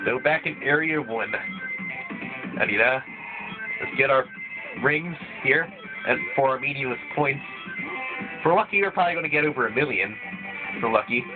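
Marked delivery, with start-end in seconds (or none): none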